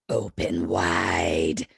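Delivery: very low, drawn-out monster rasp; Freddy. Retold or imitated. Freddy looming in Daddy Cop's nightmare, deep monster voice. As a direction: stretch it into a deep, menacing croak. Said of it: "we hear Freddy's voice go".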